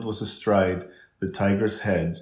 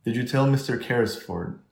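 ordinary delivery, nothing marked

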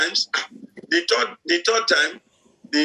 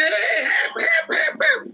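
high-pitched pretend cries for help; playful imitation of someone shouting; mock shouting; 13 semitones higher, slower than usual. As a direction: yelp for help in play, without real volume. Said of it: "Somebody will be shouting".

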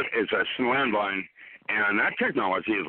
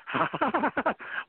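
high bursting laugh; hearty laugh; 13 semitones higher than usual. Real, not acted. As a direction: let a big laugh burst out. Laughs at own advice.

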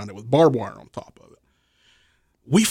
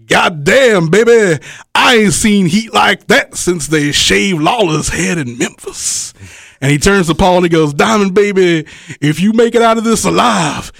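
Jimmy Valiant voice; 5 semitones higher than usual. Retold or imitated. Wrestler imitating Jimmy Valiant marveling at the crowd's heat. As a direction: ham up the veteran's amazed jive.

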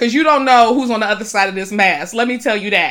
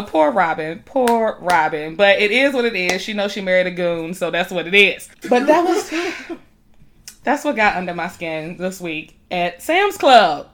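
ordinary delivery

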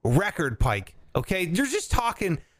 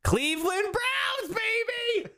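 excited cheer; 3 dB quieter, slower than usual. Real, not acted. Cheering for his team.

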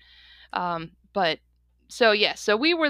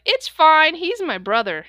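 louder, lightly voiced kid's protest; defensive kid; 6 semitones higher, 8 dB louder, slower than usual. Bigger kid excusing himself to a neighbor, lightly imitated defensiveness. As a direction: imitate a kid brushing off an adult.

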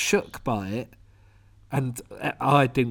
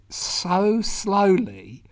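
slow, higher-pitched drawl on each word; drawn-out slowness; 5 semitones higher, much slower than usual. Retold or imitated. Describing an old lady's slow fall, drawn out. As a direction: stretch it out in slow motion.

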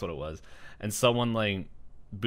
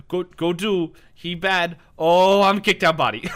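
broken speech; 7 semitones higher, 8 dB louder than usual. Retold or imitated. Possessed ghost in broken speech.

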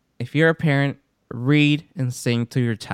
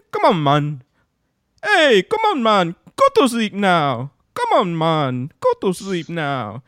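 higher, hammed-up Jamaican accent; 5 semitones higher than usual. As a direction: playfully order bedtime in a put-on Jamaican voice. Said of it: "Like, Jamaican?".

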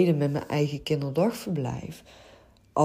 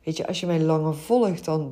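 ordinary talk, nothing marked

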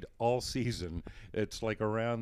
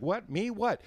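startled; 12 semitones higher than usual. Retold or imitated. Startled, called in from sideline.

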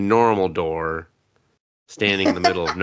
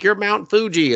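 put-on kid's squeal; 11 semitones higher, 4 dB louder than usual. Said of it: "They're like".

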